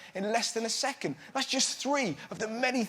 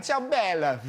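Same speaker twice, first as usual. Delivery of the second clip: put-on Italian accent.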